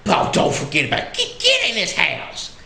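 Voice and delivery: high-pitched granny shooing guests inside; Southern old lady. Retold or imitated. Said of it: "sweet little old lady".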